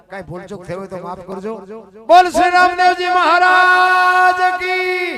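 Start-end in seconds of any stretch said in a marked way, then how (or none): 2.1-5.1 slow, long, higher and louder call to the crowd; slow, higher, louder closing chant of praise to Ramdevji; devotional call-out. Real, not acted. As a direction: stretch each word out as a shouted invocation for the audience to answer.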